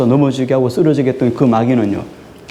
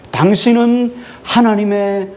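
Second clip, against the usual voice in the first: drawn-out raised call; mock crying out; 6 semitones higher, slower than usual. Preacher voicing the demon crying out to Jesus. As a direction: cry it out slowly like a demon. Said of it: "부르짖고 있어요".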